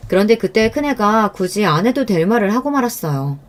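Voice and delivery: usual speaking voice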